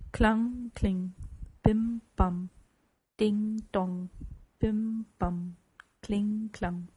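ringing bell imitation, evenly tolled; church bells pealing. A narrator's reading. Church bells ring out for Scrooge, tolling syllables. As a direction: chime each syllable like a bell, with pauses.

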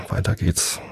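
ordinary delivery

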